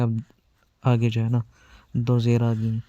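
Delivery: ordinary delivery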